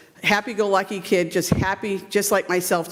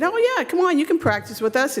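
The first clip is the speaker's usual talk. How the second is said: higher, cheery invitation from the coach; friendly coach; 9 semitones higher than usual. Retold or imitated. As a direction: wave the little girl onto the field. Coach quoted inviting the girl.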